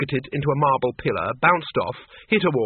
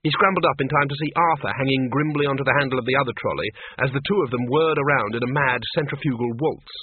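ordinary delivery, nothing marked

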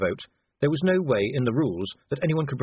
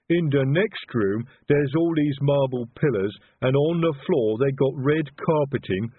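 slow, lumbering uncultured explainer; Detritus, expansive troll; 4 semitones higher, 4 dB louder than usual. A narrator's reading.

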